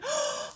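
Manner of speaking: very high theatrical gasp; dramatic gasp. Pretend. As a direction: gasp at a huge disaster. Huge math emergency.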